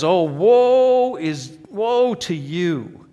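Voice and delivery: mournful prophet crying woe; solemn prophetic woe. Shown as a demonstration. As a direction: intone it like an ancient prophet. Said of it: "if someone just says".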